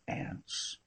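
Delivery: a breathy, whispered echo of the word "Aunts?"; unvoiced questioning "Aunts?"; puzzled whisper. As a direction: whisper "Aunts?" back in disbelief.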